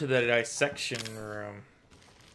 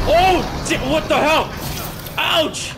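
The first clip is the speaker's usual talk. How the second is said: much higher, much louder panicked screams; terrified screaming; 14 semitones higher, 9 dB louder, slower than usual. Real, not acted. Gamer attacked by a monster, terrified screaming. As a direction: scream in real terror.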